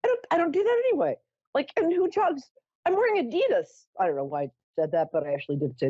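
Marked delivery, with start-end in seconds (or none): 0.0-3.9 high, fast, flustered denial; retelling her own high-pitched flustered protest to the crowd; indignant protest. Retold or imitated. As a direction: insist in a rush that you're not high. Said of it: "I'm like".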